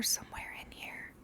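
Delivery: ominous whisper; hushed and secretive. Real, not acted. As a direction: whisper it like a ghost story. Spooky thought of mouse parents.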